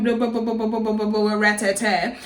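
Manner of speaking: rapid monotone blah-blah of a row; mock argument babble. Summing up the shouting match, mock babble. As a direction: rattle off nonsense syllables on one note. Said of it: "started shouting".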